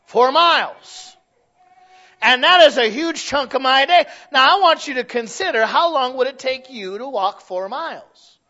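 loud, rising pulpit preaching; hammering each number slow and loud from the pulpit; preaching at full force. Acted. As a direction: preach it out to the congregation.

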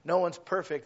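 usual speaking voice

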